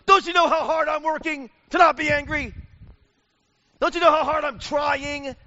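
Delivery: high, loud, indignant shout; hurt and furious, fight back; defensive outburst. Retold or imitated. Defensive perfectionist lashes back.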